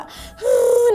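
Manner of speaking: high, drawn-out mock sob; put-on high-pitched weeping; imitated crying wail. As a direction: wail a long "huu" like a weeping granny. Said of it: "tiba-tiba Nek Mary pula yang nangis".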